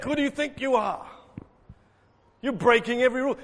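imitating scandalised complainers laying down the law; indignant objectors. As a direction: accuse like an outraged rule-keeper. Acting out the complaining religious objectors.

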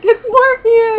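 high, loud retold fan cry; over-excited blurt. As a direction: re-enact blurting out the wrong name, star-struck. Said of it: "I'm so excited".

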